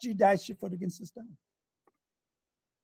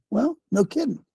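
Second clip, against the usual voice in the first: usual speaking voice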